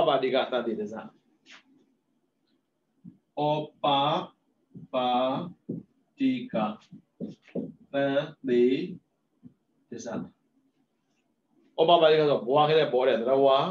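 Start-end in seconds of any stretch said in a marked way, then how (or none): 3.4-10.1 ဩ, ပ, ပါ, တိက, သန္တေ, ရဇ each said alone with long gaps; syllable by syllable. Shown as a demonstration. Monk spelling the Pali word for his students, one syllable at a time. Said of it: "syllables said singly".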